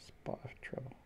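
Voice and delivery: soft unvoiced whisper of the phrase; hushed, secret whisper. Shown as a demonstration. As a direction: breathe it out with no voice.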